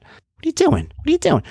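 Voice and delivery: incredulous, high-pitched self-scolding; exasperated self-talk. Retold or imitated. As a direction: chide yourself in disbelief. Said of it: "I'm talking to myself".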